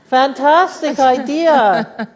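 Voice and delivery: high, loud and slow, hammed-up delight; mock enthusiasm. Principal to students proposing a debating club, in hammed-up delight. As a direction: stretch out fake delight for the punchline.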